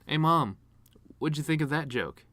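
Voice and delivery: ordinary delivery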